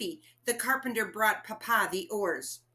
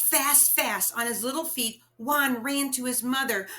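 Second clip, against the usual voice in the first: plain reading voice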